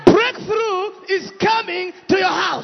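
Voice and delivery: rising, shouted declarations; preaching building up. Acted.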